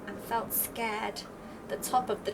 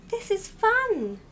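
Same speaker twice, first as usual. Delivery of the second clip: high, louder, slow gleeful chick; delighted little owl; 11 semitones higher, 6 dB louder, slower than usual. Acted. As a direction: savour it up high with joy. Little Owl voiced by narrator.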